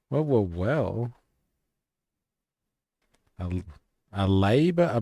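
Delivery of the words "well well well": mock intrigue. Real, not acted.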